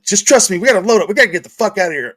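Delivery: injured worker urging his coworker to get out; urgent. Retold or imitated. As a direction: push him to leave now, fast at the end. Urgent retold plea to leave.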